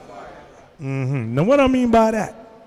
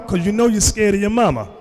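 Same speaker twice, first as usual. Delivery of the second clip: normal talking voice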